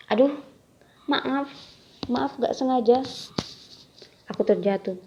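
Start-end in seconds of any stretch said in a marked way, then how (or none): 0.1-3.0 startled, higher and louder sorry; flustered apology. Acted. Bumps into someone, apologizes.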